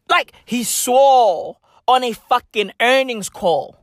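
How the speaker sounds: ordinary talk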